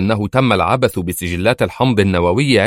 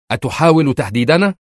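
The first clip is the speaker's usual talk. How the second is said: higher-pitched, heated challenge; outraged; 6 semitones higher, 4 dB louder than usual. A narrator's reading. Foreign minister accusing the director of threats, outraged. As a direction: flare up at the threat, pitch raised. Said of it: "وقالت في ثورة".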